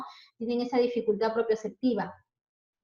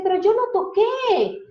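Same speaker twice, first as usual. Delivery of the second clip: imitating a child's protest; 9 semitones higher, 5 dB louder than usual. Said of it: "Porque dicen".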